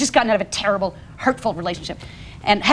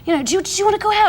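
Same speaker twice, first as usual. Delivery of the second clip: quick, higher, lightly put-on caller; Eddie Izzard; 8 semitones higher, much faster than usual. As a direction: rush the invitation in a mock British voice. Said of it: "it's [name] as Eddie Izzard".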